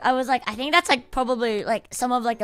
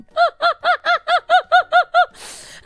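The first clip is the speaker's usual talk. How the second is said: loud, high self-mocking laugh; high burst of laughter; 9 dB louder than usual. Real, not acted. Laughing at himself for forgiving his friend. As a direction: laugh hard at yourself.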